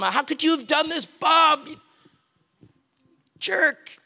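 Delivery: high, mock-angry yelling at a jerk; hammed-up scolding, pitch up high; pretend yell at neighbor. Furious at neighbor Bob for cutting trees, mock yell. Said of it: "I yell at him".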